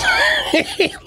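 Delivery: high squealing burst of laughter; a real high-pitched cackle of delight; shrieking laugh. Real, not acted.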